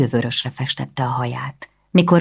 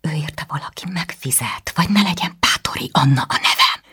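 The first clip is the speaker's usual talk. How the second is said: furious hissed whisper. A narrator's reading. Anna hisses back at her brother, whispering. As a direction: spit it out under the breath.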